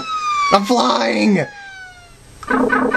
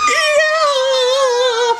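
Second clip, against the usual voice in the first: high princess cheer. Acted.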